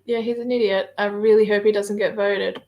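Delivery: usual speaking voice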